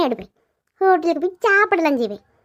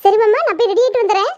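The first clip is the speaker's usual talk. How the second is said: delighted; 6 semitones higher, 4 dB louder than usual. Acted. Wife delighted husband agrees.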